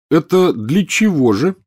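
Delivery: slow, high doubtful question; sceptical. A narrator's reading. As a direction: raise a sceptical eyebrow in the voice. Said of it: "скептически поинтересовался Рон".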